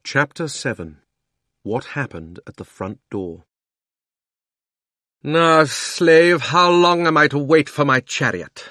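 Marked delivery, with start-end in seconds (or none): 5.2-8.6 loud, high, imperious demand; thundering witch. Acted. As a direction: thunder at a slave like a queen. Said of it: "thundered the witch".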